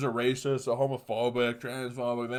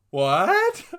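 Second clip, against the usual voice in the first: indignant what; 8 semitones higher, 9 dB louder than usual. Retold or imitated. Outraged reaction to pop charge.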